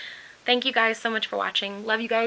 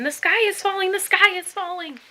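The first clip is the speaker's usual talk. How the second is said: high, loud panicked scream; 10 semitones higher, 6 dB louder than usual. Acted. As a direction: scream it in terror from the wreck.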